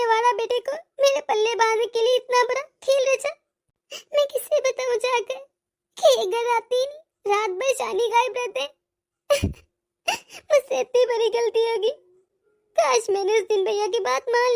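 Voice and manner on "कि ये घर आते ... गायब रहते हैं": usual speaking voice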